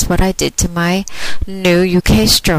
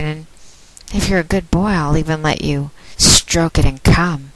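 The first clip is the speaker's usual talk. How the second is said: ordinary talk, nothing marked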